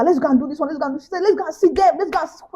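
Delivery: hot-tempered urging. Retold or imitated. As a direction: imitate an impatient friend rallying the group. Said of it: "she always had hot temper".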